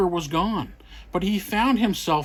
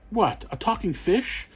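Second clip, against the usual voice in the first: ordinary delivery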